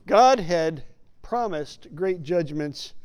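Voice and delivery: ordinary talk, nothing marked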